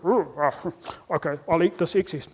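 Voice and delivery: low, soft grumbling; deflated human. Acted. Human left without food, low resigned grumble. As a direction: give in with a low groan. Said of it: "Human goes".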